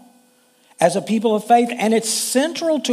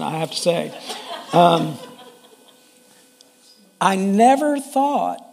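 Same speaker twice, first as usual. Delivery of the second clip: ordinary talk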